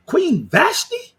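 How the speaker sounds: high, slow disbelieving Queen Vashti; incredulous. Real, not acted. Scoffing at the Queen Vashti comparison, high and drawn out. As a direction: stretch it out in mocking disbelief.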